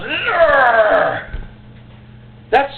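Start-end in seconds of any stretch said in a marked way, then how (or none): 0.0-1.3 loud, strangled growl of frustration; frustrated growl. Retold or imitated. Reacting to a passive prayer, frustrated growl. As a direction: let a raw growl out of the gut. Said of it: "everything inside you goes".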